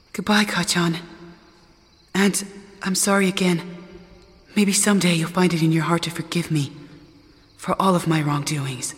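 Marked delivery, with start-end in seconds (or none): none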